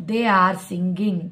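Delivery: very slow, word by word; slow model sentence. Shown as a demonstration. Teacher reading an example slowly to students. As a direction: lay each word down for the class.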